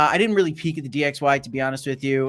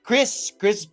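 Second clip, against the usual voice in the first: higher-pitched, lightly voiced chummy host; coaxing agreement in a raised voice; mock press-event host; 6 semitones higher than usual. Politician showing off roundtable talks to the press, higher-pitched mock chumminess. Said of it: "brought the press in to show everybody".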